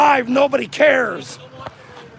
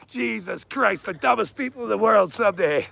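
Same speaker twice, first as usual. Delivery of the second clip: usual speaking voice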